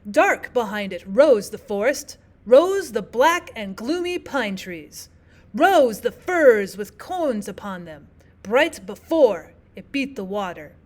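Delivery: measured strong-weak verse, heavy on Dark, rose, bright, beat; trochaic reading. Shown as a demonstration. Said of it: "start off with the stressed syllable".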